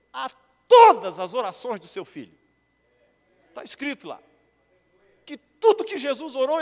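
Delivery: loud, high, emphatic sermon delivery; ringing pulpit proclamation; forceful preaching. Real, not acted. Preaching builds to full force.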